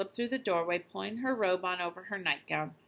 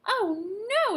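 much higher, louder dismayed cry; exclaimed; 14 semitones higher, 6 dB louder than usual. A narrator's reading. Mother hearing there's no aspirin, high dismayed exclamation. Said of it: "exclaimed the mother".

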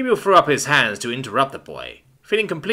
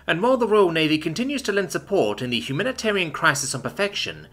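higher, measured newsreader delivery; news read; 7 semitones higher than usual. Acted. TV news report playing in the living room, newsreader voice.

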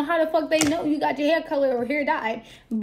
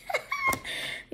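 laugh; 9 dB quieter than usual. Real, not acted. Laughing at the spirit's answer.

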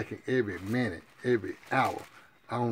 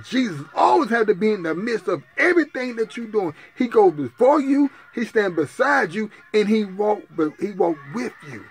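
loud, rising preacher's cry; full-force sermon delivery; fiery preaching; 8 semitones higher, 9 dB louder than usual. Acted. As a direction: preach it to the back pews.

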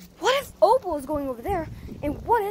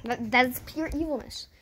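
ordinary talk, nothing marked

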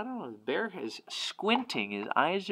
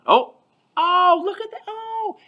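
high, loud delighted oh; excited gasp; 11 dB louder than usual. Real, not acted. Teacher delighted by a picture.

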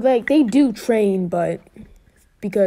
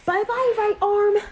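hero's farewell; 11 semitones higher than usual. Retold or imitated. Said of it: "he's like".

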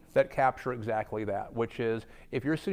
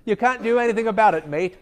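hammed-up British accent, much higher and louder; mock British accent; 9 semitones higher, 9 dB louder than usual. Joking that Brits sound believable, mock British accent. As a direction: toss it off in a jokey British accent. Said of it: "that's a great British accent".

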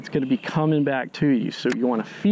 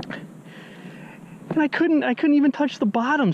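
surprised; 13 semitones higher, slower than usual. Real, not acted. Surprised at deep water.

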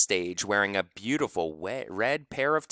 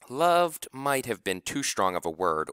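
usual speaking voice

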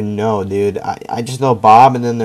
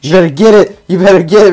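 loud taunt; 7 semitones higher, 9 dB louder than usual. Real, not acted. Taunting opponent mid-fight.

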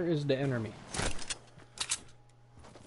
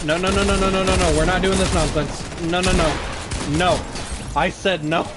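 loud, higher string of no's; loud panicked no; 4 semitones higher, 7 dB louder than usual. Real, not acted. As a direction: shout no over and over. Refusing incoming attack in game.

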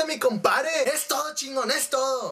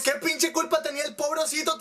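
ordinary talk

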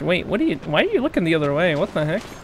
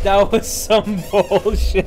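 laughing; 3 semitones higher, much slower than usual. Real, not acted. Repeat with laughter.

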